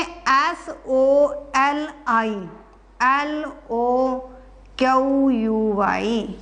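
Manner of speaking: letters spelled out slowly one by one; slow spelling out. Shown as a demonstration. Teacher spelling soliloquy for students, slow and deliberate. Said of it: "उसके बाद है सललिक्यू".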